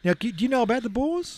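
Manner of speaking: bad South African accent; high, hammy South African accent. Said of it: "do my bad South African accent".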